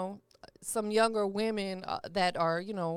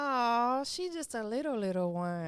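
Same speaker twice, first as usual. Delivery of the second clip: affectionate, softened aww; tender cooing. Real, not acted. Cooing on hearing of toddler.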